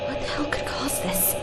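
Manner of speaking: awed whisper. Acted. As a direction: breathe the question in disbelief. Awed at ravaged city.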